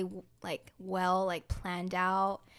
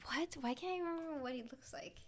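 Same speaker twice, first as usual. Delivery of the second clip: whispered to herself; 6 semitones higher, 10 dB quieter than usual. Real, not acted. Frustrated trying to recall actor.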